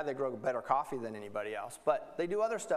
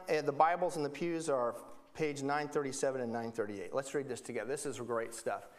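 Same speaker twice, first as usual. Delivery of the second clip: usual speaking voice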